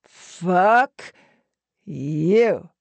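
loud first word, long pause, then the second; slow, punched-out insult through a smile; hard-smiling taunt. A narrator's reading. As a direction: split the insult in two and savour it. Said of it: "smiling hard".

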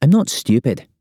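quick indignant snap, pitched high; snapping. A narrator's reading. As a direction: snap back, offended. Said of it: "she snaps".

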